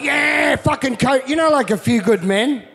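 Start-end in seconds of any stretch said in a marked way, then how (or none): 0.0-1.3 rally the mates with a raised shout; fired-up retold group yell; rowdy war cry.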